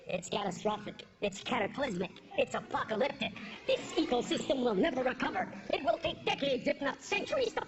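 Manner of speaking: doom it out like a TV anchor, low and hushed; mock news anchor.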